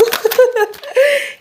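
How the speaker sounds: bright laugh rising up; high laugh. Real, not acted. Laughs after addressing Kansu.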